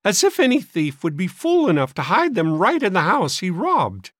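high, scornful cracked voice; suspicious old maid. A narrator's reading. Sister scorning the boys' theory, suspicious. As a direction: scoff in a high fussy voice. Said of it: "viewed the boys with frank suspicion".

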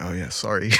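put-on meek, soft London accent; sheepish London-accented apology, softly. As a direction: quietly drop back into a London accent. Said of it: "straight back to his London accent".

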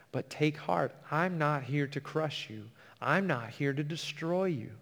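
soft, gentle promise of comfort; tender reassurance. Acted. Jesus soothing a worried believer, soft and tender. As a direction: reassure a frightened listener quietly.

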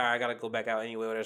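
fast, soft, brushing it off; quick resigned aside; resigned mutter. Retold or imitated. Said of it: "I'm like".

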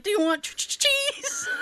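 high squeaky mocking voice. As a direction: squeal it like a parody. Mocking [name] at McDonald's.

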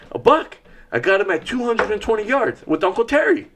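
put-on high-pitched, breathless boy bragging; excited kid. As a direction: let the boy's pride burst out. Son thrilled about his buck.

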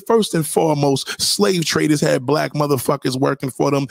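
ordinary talk, nothing marked

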